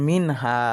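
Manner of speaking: "মিন হা" with a clean n, no nasal hold; clear, no ghunna. Shown as a demonstration. Teacher showing the izhar rule, "মিন হা" clear without ghunna. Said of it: "গুন্না ছাড়া স্পষ্ট করিয়া পড়িতে হয়".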